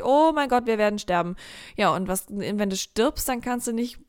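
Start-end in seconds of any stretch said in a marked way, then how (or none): 0.0-1.4 hammed-up fear of dying; body in panic mode. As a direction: play up the alarm of a stressed body. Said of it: "dass dein Körper denkt".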